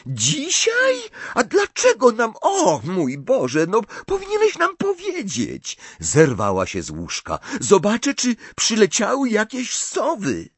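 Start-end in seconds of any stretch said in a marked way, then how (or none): 0.0-5.8 put-on very high, frantic girl's exclamations; panicked, squealing reproach; shocked cry. A narrator's reading. Hermiona reproaches Harry for not telling them, in a shocked cry. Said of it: "krzyknęła Hermiona". 7.6-10.5 high, louder, urgent voice; frantic excitement. A narrator's reading. Hermiona rushes off to look for owls, frantically excited.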